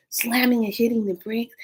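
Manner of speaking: ordinary delivery, nothing marked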